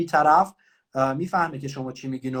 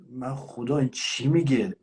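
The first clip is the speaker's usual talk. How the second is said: normal talking voice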